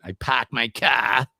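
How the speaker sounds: slow, put-on Boston accent with dropped r's. Shown as a demonstration. Host showing why people think Boston, exaggerated Boston accent. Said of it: "people think I'm from Boston".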